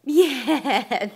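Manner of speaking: laughing yeah, loud and bright. Real, not acted. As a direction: laugh out the yes. Baby tries the word.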